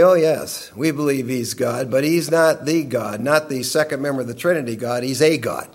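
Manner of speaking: a gentle put-on of a door-to-door missionary explaining. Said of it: "they'll say".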